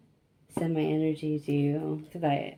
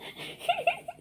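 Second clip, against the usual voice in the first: high giggle; 6 dB quieter than usual. Real, not acted. Laughing at own tangent.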